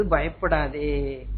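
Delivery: low, soft and drawn out; quiet, very slow comforting voice of God; gentle reassurance. Acted. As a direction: slowly soothe her fear away. God reassures Hagar in acted quote.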